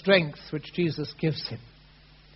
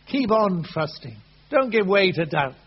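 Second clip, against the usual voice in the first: urging on; 3 semitones higher, 8 dB louder than usual. Acted. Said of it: "It's wanting to urge him on".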